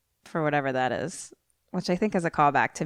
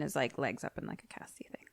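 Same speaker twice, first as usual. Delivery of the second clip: breathy trailing whisper; mumbling the end under breath; 4 semitones lower, 15 dB quieter than usual. Real, not acted. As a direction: drop to a whisper.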